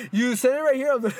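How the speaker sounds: high, loud words breaking into laughter; laughing. Real, not acted. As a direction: accuse them while cracking up. Laughing at partner's slip.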